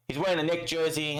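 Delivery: ordinary delivery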